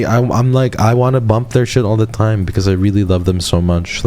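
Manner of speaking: usual speaking voice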